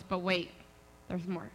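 low, softened follow-up; ominous aside. Retold or imitated.

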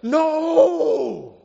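long, high mock cry of protest; alarmed shout. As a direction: cry out to stop him, hammed up. Imagined alarmed reader stopping Peter.

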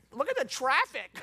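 high, loud mock horror; comic dismay. Preacher mocking Tampa to his congregation, comically appalled. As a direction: exclaim it in hammed-up disgust.

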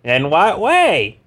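high, slow suspicious question; high wary Carr voice. Acted. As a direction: probe it slowly in the Carr voice. Carr voice on phone.

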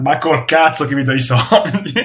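normal talking voice

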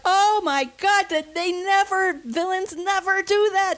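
high, slow, thrilled gasping awe; excited disbelief. Retold or imitated. Film fan recalling the shocking villain scene, high and thrilled. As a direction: replay the jolt of seeing it first time. Retelling sitting bolt upright in shock.

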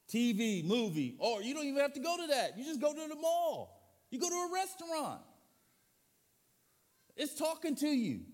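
high, loud building preacher voice; rising preaching cry. Acted. Pastor warning about the world's noise, climbing loud and high. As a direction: climb up and hammer it.

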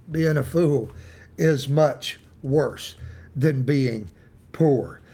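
ordinary talk

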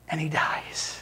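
low, soft, near whisper; hushed solemnity. Real, not acted. Preacher telling the congregation of Jesus' death, hushed. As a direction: drop to a hush for the death.

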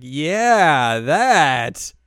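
loud, high, drawn-out phony yes; over-eager agreement. Retold or imitated. Upperclassman covering up for the club, over-eager. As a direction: jump on the excuse too keenly.

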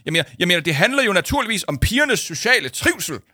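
barely hide the cake excitement; excited. Acted. Paul on the phone, thrilled about chocolate cake.

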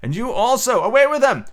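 high, ringing command; imperious order. Acted. Peter sending the brothers off to work, imperious. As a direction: dismiss them, rising to a loud finish.

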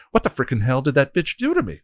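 angry shaken shout; yelling. Acted. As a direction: rage at the injury. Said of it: "Glory yelled".

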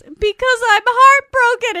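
high, loud anguished cry; retold emotional blurting; heartbroken outburst. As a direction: burst out on the edge of tears. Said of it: "she starts crying".

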